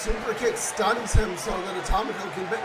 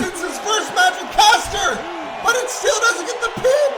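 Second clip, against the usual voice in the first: excited yelling; 7 semitones higher, 7 dB louder than usual. Real, not acted. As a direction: build the hype to full roar. Climactic move and near fall.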